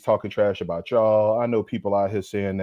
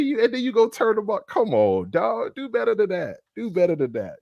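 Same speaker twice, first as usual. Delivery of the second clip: 1 high scolding; 11 semitones higher than usual. Real, not acted. Scolding player, pitch jumps high.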